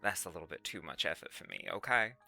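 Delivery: put-on low, subdued Southern accent; emo Southern. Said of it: "Emo Southern?".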